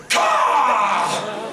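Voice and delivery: shouted bird caw; crow caw. Acted. Davos as crow.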